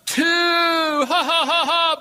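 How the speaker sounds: loud, high jeering count with laughter; mocking crowd count. Five thousand students jeering at the heckler, loud mocking laughter. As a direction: shout the count and laugh at him. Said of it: "And in mockery".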